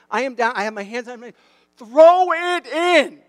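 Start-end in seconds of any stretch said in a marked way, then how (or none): 1.8-3.2 drawn-out, high, full-volume yell. Retold or imitated. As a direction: yell it slowly across a ball field. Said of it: "I'm yelling at him".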